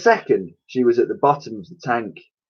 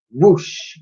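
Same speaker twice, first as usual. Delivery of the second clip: whooshing sound; 5 semitones higher than usual. A narrator's reading. Narrator voices wind.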